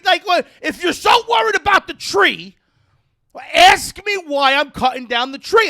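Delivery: loud, high retold scolding; pissed-off rant. Tree owner tells cyclist to just ask, angry loud rant. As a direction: lecture the cyclist at a shout. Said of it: "It pissed me off".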